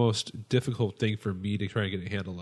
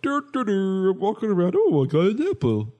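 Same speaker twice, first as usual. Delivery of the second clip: high, dopey singsong; oblivious Higgins; 9 semitones higher, 4 dB louder than usual. Retold or imitated. Host mocks oblivious Higgins in a high dopey voice. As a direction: wander happily with no clue. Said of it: "He was kinda like".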